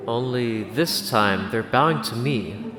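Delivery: ordinary delivery, nothing marked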